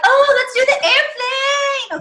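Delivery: high, drawn-out playful cheer; excited parent. Acted. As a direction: coax a child to eat with big excitement. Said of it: "you're excited".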